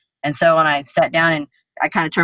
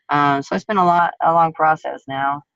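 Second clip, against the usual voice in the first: normal talking voice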